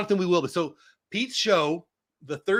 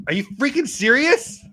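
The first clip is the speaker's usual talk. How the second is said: higher, louder, are you freaking serious; stunned disbelief; 11 semitones higher, 8 dB louder than usual. Real, not acted. Streamer stunned by a viewer's claim, loud disbelief. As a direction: blurt it out in shock.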